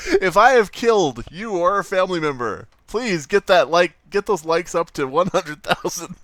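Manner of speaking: loud, high, fighting back laughter; laughter-strained. Real, not acted. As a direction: push the plea out between laughing fits. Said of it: "having trouble breathing through the laughter".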